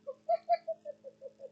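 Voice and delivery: short high laugh; amused laugh. Real, not acted. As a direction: laugh at your own joke. Narrator laughs.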